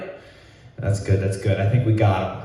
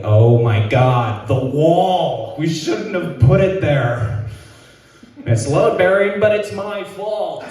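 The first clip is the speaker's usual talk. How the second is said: high, slow, mock panic; panicked; 7 semitones higher, slower than usual. Brother frets high and slow about the punched wall. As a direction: fret over the broken wall. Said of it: "I'm like".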